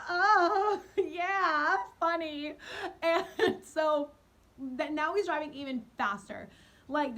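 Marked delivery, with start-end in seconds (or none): 0.1-2.5 high, loud, forced laugh-cry through "Oh, yeah, funny"; nervous laugh-crying. Retold or imitated. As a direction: laugh it off while terrified, voice cracking. Nervous reply to serial killer joke.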